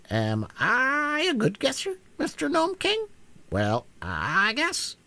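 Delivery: high, slow, gloating hen voice; smug taunt. Acted. Hen gloats at the Gnome King, slow and smug. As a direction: crow over the beaten king.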